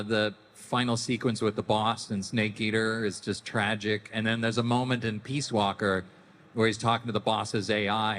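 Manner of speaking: ordinary talk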